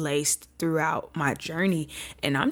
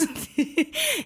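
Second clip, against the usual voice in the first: amused laugh; 10 semitones higher than usual. Real, not acted. Laughs at her Jonah line.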